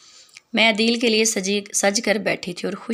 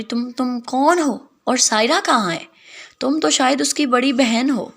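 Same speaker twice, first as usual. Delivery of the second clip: higher, startled demand from the mother-in-law; stunned, pitched up, who are you; shocked mother-in-law; 5 semitones higher than usual. Acted. Shocked mother-in-law confronts stranger bride. (ساس کی چونکی ہوئی اونچی آواز)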